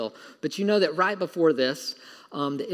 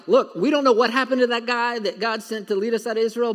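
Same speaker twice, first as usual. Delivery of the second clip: impatient crowd; 6 semitones higher, 5 dB louder than usual. Retold or imitated. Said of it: "they're like".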